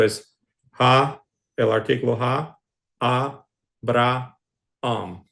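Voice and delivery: slow "ja, a, bra, am" with each vowel kept apart; sounding out every vowel. Shown as a demonstration. Teacher to Greek class, slowly sounding out each vowel. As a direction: spell out "ja, a, bra, am", every vowel its own beat. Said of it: "hay que pronunciar todas las vocales".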